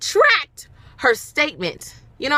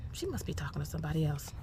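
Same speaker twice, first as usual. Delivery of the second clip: whispered thought; 8 semitones lower, 15 dB quieter, faster than usual. Retold or imitated. Inner thought after "I'm like.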